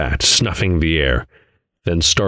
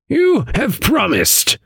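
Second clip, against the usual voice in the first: put-on stern old man voice; slower than usual. Adam answering Vane.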